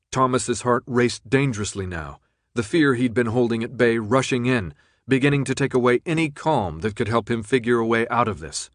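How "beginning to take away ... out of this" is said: ordinary delivery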